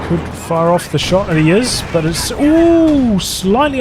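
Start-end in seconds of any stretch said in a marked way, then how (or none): 2.0-3.8 drawn-out surprised ooh. Real, not acted. Shot nearly lands.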